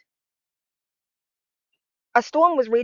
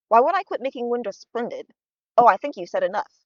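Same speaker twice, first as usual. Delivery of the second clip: usual speaking voice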